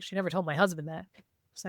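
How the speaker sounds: ordinary talk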